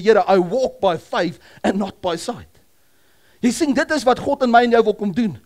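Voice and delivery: loud, high, fervent sermon delivery; loud preacher voice; preaching at full force. Acted. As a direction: proclaim it to the whole congregation.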